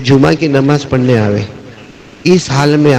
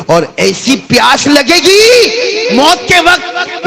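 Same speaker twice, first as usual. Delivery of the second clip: high, drawn-out preacher's wail; anguished warning; 14 semitones higher, much slower than usual. Acted. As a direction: stretch it slowly into a high, dire cry. Warning of agony at death. (دردناک، لمبی اونچی پکار)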